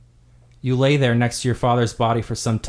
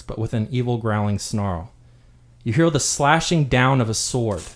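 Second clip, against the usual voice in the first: ordinary talk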